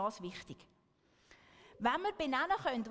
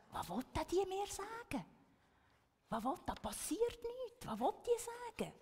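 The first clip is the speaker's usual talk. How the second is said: higher-pitched puzzled wondering; mock bewilderment, pitched up; baffled inner question; 6 semitones higher, 3 dB quieter than usual. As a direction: act out not getting what someone means. Acting out baffled thoughts of listener.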